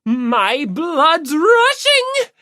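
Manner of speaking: high, loud, drawn-out exclamation; overexcited Yamada. Acted. Yamada thrilled by kneading dough, slow loud overexcited cry. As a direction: let the fanboy excitement boil over. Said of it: "all the more excited".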